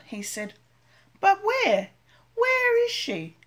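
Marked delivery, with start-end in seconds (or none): 1.2-3.4 high, slow, anxious little voice; a lost chick fretting aloud; worried baby bird. Acted. Baby bird wondering where mother is, anxious and high.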